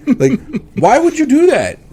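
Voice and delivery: laughing, high-pitched exasperated plea; exasperated rant. Real, not acted. Ranting at the creators.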